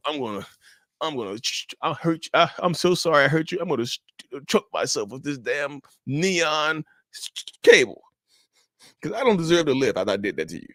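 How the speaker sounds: high, choked-up fake crying plea; mock tearful apology. Cheater begs a partner with threats of self-harm, mock tearful. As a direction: imitate a sobbing cheater's over-the-top apology. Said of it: "they're sorry 'cause they're crying".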